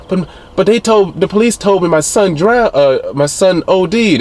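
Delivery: high, pleading voice for the grieving mother; imitating the distraught mother. Recapping the mother confronting her son's killer, high, disbelieving voice.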